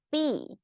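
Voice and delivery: "B" sliding down from high; "B" as the end of a statement; falling intonation. Shown as a demonstration. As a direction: drop the pitch through "B". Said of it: "goes down".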